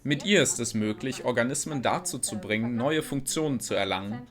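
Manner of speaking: ordinary talk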